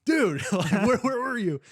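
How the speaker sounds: high, loud, laughing disbelief; excited shout. Retold or imitated. Friends greet the bleeding buddy, loud high laughing shout. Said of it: "And we're like".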